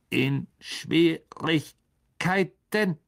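langsam gedehnte Frage, höher; alte Spinne, leicht besorgt. Acted. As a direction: frag langsam wie eine alte Spinne. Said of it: "sagte die alte Spinne". (alte Spinne fragt gedehnt, leise besorgt)

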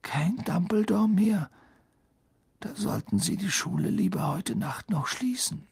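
high, whispery alarm; hoarse. A narrator's reading. Boy dismayed at losing the headmaster, hoarse. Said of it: "sagte er heiser".

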